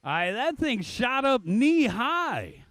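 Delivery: fast excited race call; play-by-play. Acted.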